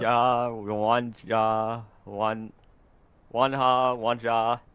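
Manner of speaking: "Hwanja" tested several ways, low; trying out pronunciations. Real, not acted. Co-caster attempting a racer's name, trying out pronunciations. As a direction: feel out "Hwanja", low and piece by piece. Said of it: "I'm so bad at pronouncing names".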